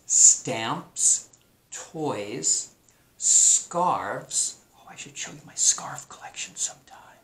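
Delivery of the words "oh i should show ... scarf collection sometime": whispered aside about the scarf collection. Real, not acted. Teacher whispers a secret aside to students.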